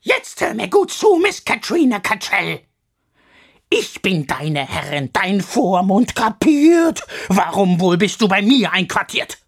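loud, spitting anger; furious scolding. Acted. Misses Crabone furiously scolding Katrina. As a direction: tear into her with hate. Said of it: "vor Wut und vor Hass".

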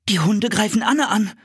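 sudden fear for his sister; breathless alarm; alarmed thought. A narrator's reading. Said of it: "dachte Philipp".

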